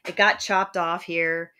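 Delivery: usual speaking voice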